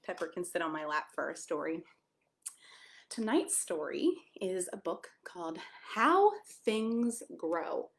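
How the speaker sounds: plain reading voice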